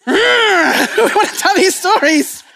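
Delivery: high laughing outburst. Real, not acted. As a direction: laugh through the words, slowly. Laughing high, slow burst.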